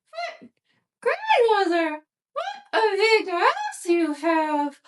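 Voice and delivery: put-on high, slow, sweet little girl. A narrator's reading. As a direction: answer innocently in a high little girl's voice. Girl marvels at the wolf.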